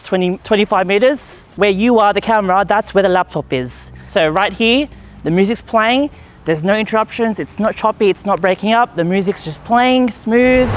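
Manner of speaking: calling from a distance. Real, not acted. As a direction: call back to the camera from twenty meters off. Calling back from far away.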